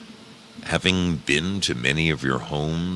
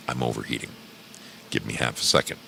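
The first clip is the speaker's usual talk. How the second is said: usual speaking voice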